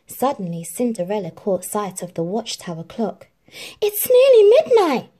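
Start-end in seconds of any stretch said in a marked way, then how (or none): 3.8-5.1 high, loud gasp of panic; gasped alarm. Acted. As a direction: suddenly realise it's too late. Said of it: "she gasped".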